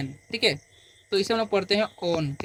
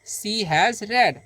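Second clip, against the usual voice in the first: धीमा स्पष्ट उच्चारण; 3 semitones higher, much slower than usual. Shown as a demonstration. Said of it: "तो बोलेंगे".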